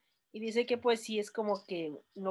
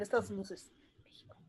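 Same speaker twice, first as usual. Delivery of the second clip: joking whisper; 7 dB quieter, faster than usual. Acted. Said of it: "joking aside".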